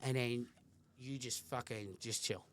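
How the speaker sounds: soft, low, gentle voice; tender reassurance. Retold or imitated. Dad telling daughter her room is safe, softly. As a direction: reassure a child quietly. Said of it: "I just goes".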